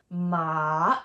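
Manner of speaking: "Ma" sinking low, then swinging up; long dipping-tone "Ma"; third tone. Shown as a demonstration. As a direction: draw out "Ma" down and up. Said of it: "It goes down and up".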